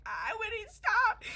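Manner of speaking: loud, high, drawn-out mock wail; retold yell. Injured storyteller replaying smoke blown onto her, mock yell. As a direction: cry out in hammed-up protest.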